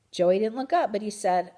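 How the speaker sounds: ordinary delivery, nothing marked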